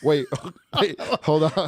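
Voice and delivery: laughing through a startled wait, hold on; mock the onlookers' panic while laughing; laughing mock alarm. Onlookers seeing the violin handed over, laughing mock alarm.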